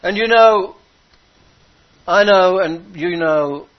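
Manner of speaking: high, loud, full-force preaching; impassioned preaching. Acted. Preacher to congregation, preaching at full force. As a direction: lift the congregation with rising fervour.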